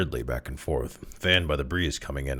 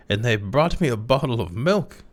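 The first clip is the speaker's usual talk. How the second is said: put-on higher, louder protesting old man; shrill protest; 7 semitones higher, 4 dB louder than usual. A narrator's reading. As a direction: rise to a shrill complaint. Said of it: "his voice rose to a shrill note of protest".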